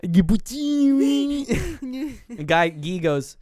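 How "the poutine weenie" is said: very high, drawn-out squeal; hammed-up old fellow, loud and slow; old man impression.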